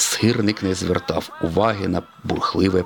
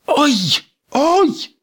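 high, loud yelps of fright; cartoon yelps mid-crash; alarmed cry; 5 dB louder than usual. A narrator's reading. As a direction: yelp out as you crash. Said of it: "закричав сирник".

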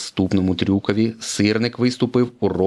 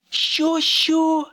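high, baffled double question; Lightning caught off guard, surprised. A narrator's reading. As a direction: squeak it out in disbelief. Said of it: "здивувався Блискавка".

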